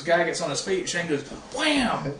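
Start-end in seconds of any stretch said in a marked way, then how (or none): 1.5-2.1 high-pitched exclaimed wham; imitating hits with a high, sharp cry; acted-out blows. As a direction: punch out each word like a landing blow. Acting out the wrestler's blows.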